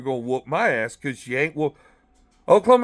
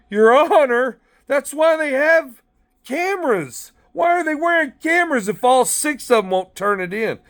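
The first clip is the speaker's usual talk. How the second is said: raised, high indignant plea to the judge; pleading to the judge; 8 semitones higher than usual. Retold or imitated. In court, pleading with the judge, indignant and high.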